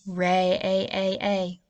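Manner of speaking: Ray-a-a-a, the long a held and drawn out; stretched long a. Shown as a demonstration. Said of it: "Now think of that sound".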